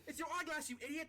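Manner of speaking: high, loud yell up the mast; loud, scornful shout; exasperated distant shout. Acted. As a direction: holler at a dim lookout overhead. Shouting up to the crow's nest.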